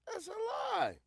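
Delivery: high, loud shout of disbelief; shouted denial. Real, not acted. Streamer shouting back at the clip, outraged. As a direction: yell it out, outraged.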